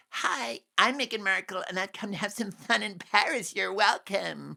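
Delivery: a hammed-up Meghan impression; mock Meghan. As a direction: parody her breezy self-introduction. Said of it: "Meghan Markle turning up".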